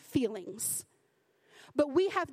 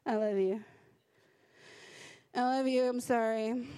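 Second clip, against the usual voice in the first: put-on grudging, low monotone child's apology; forced apology; 3 semitones lower than usual. Child made to apologize to a sibling, grudging. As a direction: mumble a mock sorry you don't mean. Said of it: "your parent made you say you love them".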